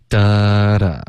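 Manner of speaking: "哒哒" as two beats, the first held long; two-beat rhythm. Shown as a demonstration. Teacher beats out the syllables of seeing, two-beat rhythm. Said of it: "两个音节".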